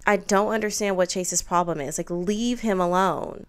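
ordinary talk, nothing marked

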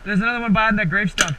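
usual speaking voice

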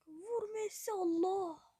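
excited shout. Real, not acted. Gamer urging Messi to shoot, excited shout. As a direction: urge the shot, then cry out. Shot on goal, exclamation.

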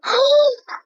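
a quick high gasp of delight; excited intake of breath; sharp excited gasp. Real, not acted. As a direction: gasp at the surprise. Discovers baby care room.

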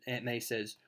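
ordinary delivery, nothing marked

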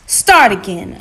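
loud, firm command; forceful, impatient order; giving an order. A narrator's reading. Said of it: "Anansi ordered".

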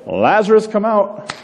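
high ringing call. Retold or imitated. Jesus calling Lazarus out of the grave, high call. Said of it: "It's like".

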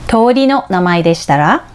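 usual speaking voice

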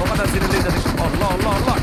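loud, angry ranting; angry outburst. Real, not acted. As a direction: let the frustration blow up at full volume. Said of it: "bugün biraz sinirliyiz".

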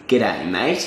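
low Australian g'day, mate rising on mate; Australian greeting. Shown as a demonstration. Teacher showing how Australians say hello, Australian accent. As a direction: greet like an Aussie, lifting on mate. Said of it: "the way that we say hello".

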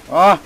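sharp loud cry of surprise; startled gasp. Real, not acted. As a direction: yelp in shock. Sudden fright in game. (ani korkuyla bağırma)

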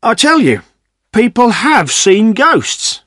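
insist, stretching the key words slowly; insistent villager. A narrator's reading. Butcher arguing with villagers about ghosts, insistent.

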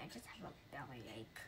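unvoiced whispered excuse. Ghost whispering his excuse, a sly whisper. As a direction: breathe it out as a whisper.

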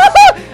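squealing laughter; high shrieking laugh. Real, not acted. Laugh during game chaos.

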